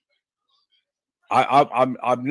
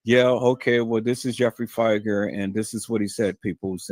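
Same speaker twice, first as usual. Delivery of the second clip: bone dry reading; 5 semitones lower, 4 dB quieter than usual. Shown as a demonstration. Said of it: "just be plain Jane, bone dry".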